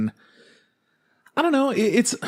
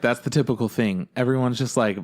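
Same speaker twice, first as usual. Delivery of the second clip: normal talking voice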